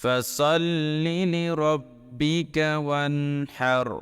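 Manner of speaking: slow, drawn-out chanted recitation; Quran recitation. Shown as a demonstration. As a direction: recite with long melodic vowels. Recites verse.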